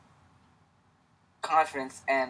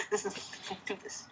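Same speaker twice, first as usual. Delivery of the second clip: whispered aside; 7 semitones higher, 18 dB quieter than usual. Real, not acted. Quiet aside while settling in.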